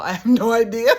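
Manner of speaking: high, loud laughing admission. Real, not acted. Laughing as she admits she had no plan for his bluff.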